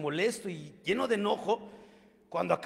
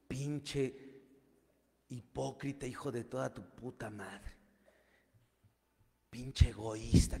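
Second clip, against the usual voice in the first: low menacing insult; 5 semitones lower, 6 dB quieter, slower than usual. Retold or imitated. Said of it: "se va recio".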